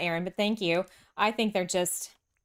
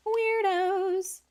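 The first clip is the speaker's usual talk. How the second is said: much higher, put-on voice on Weirdos; mock squeak; 12 semitones higher than usual. Streamer teasing a New Jersey viewer with a squeaky weirdos.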